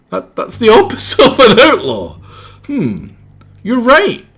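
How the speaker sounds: squeaky and indignant; high character voice. Acted. Correcting the outlaw detective, high and indignant. Voicing game character dialogue.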